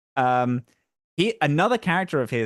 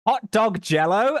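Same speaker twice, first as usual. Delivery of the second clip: high, slow, incredulous outburst; indignant disbelief; 8 semitones higher, 4 dB louder, slower than usual. Real, not acted. Briton defending British food from Americans, indignant and incredulous. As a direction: spit back the absurd dish in drawn-out indignation.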